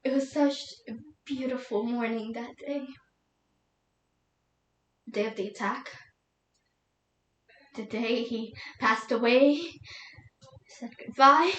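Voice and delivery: hushed, halting recollection; grieving widow, subdued. Acted. Widow recalling the morning of the attack, hushed sorrow.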